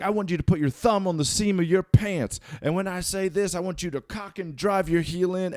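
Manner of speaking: high, loud mock drill-sergeant orders; retold boot camp yelling; imitated yelling actor. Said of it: "he was, like, yelling specifically at me".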